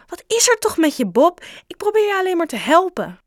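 high, loud, rushing plea; exasperated shout. Acted. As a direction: shout that you only want to help. Joe shouting at Bob.

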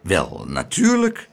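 slow, low start of mild astonishment; surprised. A narrator's reading. Mayor answering Laura's offer, surprised. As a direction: draw it out, taken aback. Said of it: "zei de burgemeester verbaasd".

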